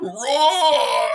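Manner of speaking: loud celebratory lion roar; triumphant roar. Pretend. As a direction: roar for the touchdown. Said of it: "add a roar soundbite".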